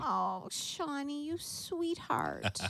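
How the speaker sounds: soft, slow, affectionate coo; touched and tender. Real, not acted. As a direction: melt with gratitude, drawing the words out. Moved by a friend's gift.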